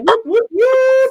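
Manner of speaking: excited cheer. Acted. Cheering for a chatter.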